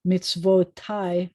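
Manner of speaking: Mitzvotai said slowly with weight on -TAI; slow pronunciation. Shown as a demonstration. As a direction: lean on the ending of Mitzvotai. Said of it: "that ending means mine".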